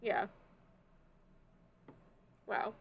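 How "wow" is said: ordinary talk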